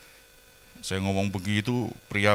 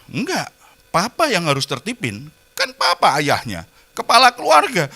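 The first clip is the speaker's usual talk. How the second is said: imitating his wife, rising higher to a firm end; mock-insistent wife pushing it back on him; wife's retort; 9 semitones higher, 7 dB louder than usual. Wife insisting her husband must do the disciplining, imitated, rising higher. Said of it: "Jawaban istri saya apa?".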